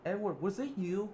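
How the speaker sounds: high, loud anxious mother's voice; a mom demanding who hurt her son; mother Alice questioning Edward in alarm; worried mother. Acted. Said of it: "Alice said".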